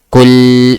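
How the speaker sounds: KUL, short u and held l, loud; correct pronunciation. Shown as a demonstration. Teacher modelling Arabic word for student, correct pronunciation. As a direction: hit KUL and hold its L. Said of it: "pronounce the L lam proper".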